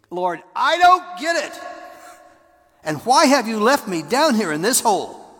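high, loud anguished plea to God; desperate cry. Retold or imitated. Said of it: "I did that a couple times".

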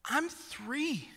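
high-pitched imitated toddler protest; three-year-old son. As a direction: plead like an overwhelmed small child. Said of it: "And he's like".

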